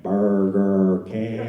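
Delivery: very slow, low, sing-song Burger King; slow, drawn-out answer. Retold or imitated. Kid behind the counter drawls the name very slowly. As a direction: stretch each syllable out long and low. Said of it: "The kid looked at him and went".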